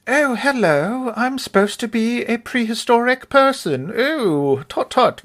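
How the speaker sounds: high, slow, fussy posh English voice; posh British caveman. Retold or imitated. Mocking the film's posh accent as a fussy caveman. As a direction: ham up a prim posh prehistoric man. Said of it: "if you're like".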